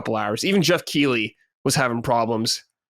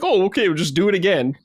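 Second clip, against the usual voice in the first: mock bank voice; 6 semitones higher, 3 dB louder, faster than usual. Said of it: "they're like".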